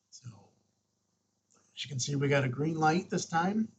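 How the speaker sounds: normal talking voice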